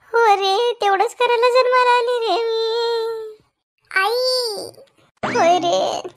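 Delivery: high, tearful complaint; a mother wailing for sympathy; mock weeping self-pity. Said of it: "काय रडतेस?".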